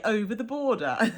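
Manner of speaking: laughing through the words. Real, not acted. Teasing Brummies with a real laugh. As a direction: giggle as the phrase lands. Joking about the Birmingham border.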